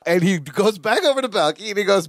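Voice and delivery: normal talking voice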